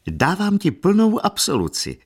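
mock-solemn, pitched high and slow; playful pardon to her niece; joking. A narrator's reading. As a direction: grant absolution with a wink. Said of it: "žertovala Dona Paolina".

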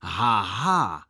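firm, low-pitched mock laugh; spoken ha-ha in a strong voice. A narrator's reading. Boy scoffing at his rival, strong voice. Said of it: "pravil jsem silným hlasem".

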